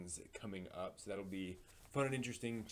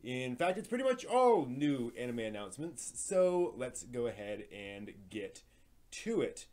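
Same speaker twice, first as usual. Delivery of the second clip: brisk, upbeat news host read, a bit louder; lively, a little louder anime news presenter voice; 5 semitones higher, 9 dB louder than usual. Acted. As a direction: present the week's anime headlines like a show host. Hosts weekly anime news segment.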